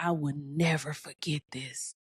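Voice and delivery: hushed, breathy whisper of a memory; whispered aside. Real, not acted. Telling of her dying mother, a whispered aside. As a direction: whisper it to yourself, voice failing.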